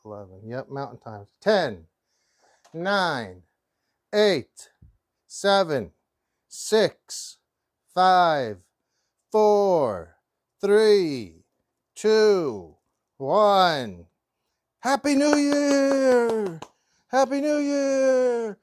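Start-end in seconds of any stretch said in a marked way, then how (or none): 1.4-14.1 loud New Year countdown; countdown shout. Real, not acted. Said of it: "do the countdown with me". 14.8-18.6 high joyful New Year shout; cheering. Real, not acted. Countdown hits midnight, cheering.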